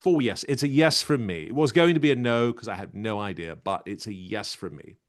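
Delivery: emphatic talent-show judge; punchy, decisive judge impression; Simon Cowell. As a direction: announce the verdict loudly, then ease off. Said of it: "wrap it up with Simon Cowell".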